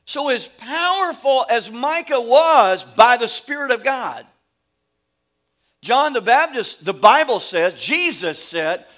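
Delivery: preacher building to a peak before the congregation; preaching at full force. Acted. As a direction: drive it home like a revival preacher.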